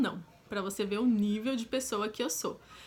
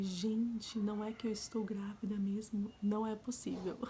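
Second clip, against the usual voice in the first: whispered in disbelief, barely breathing the words; stunned whisper; 7 dB quieter than usual. Retold or imitated. Staring at her positive test alone, stunned whisper. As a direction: whisper it like you can't believe your eyes.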